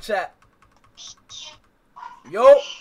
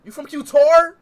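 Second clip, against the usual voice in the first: high, loud disbelief; amazed you're from Qatar; surprised; 8 semitones higher, 14 dB louder than usual. Real, not acted. Surprised at her origin.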